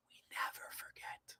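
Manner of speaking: barely audible whispered echo; fainter whisper. Pretend. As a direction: let it fade to a ghostly whisper.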